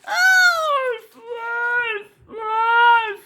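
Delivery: put-on shepherd boy's high trick cry; crying wolf. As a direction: fake alarm called high over the hills. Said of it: "opened his mouth and cried".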